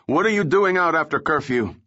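guard shouting a halt, high and urgent; shouted. A narrator's reading. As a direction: bark the order at intruders. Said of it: "a guard shouted".

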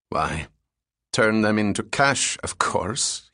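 plain reading voice

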